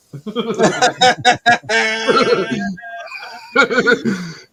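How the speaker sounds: high, loud burst of laughter; laughing. Real, not acted. Cracking up over the chair video, high loud laughter.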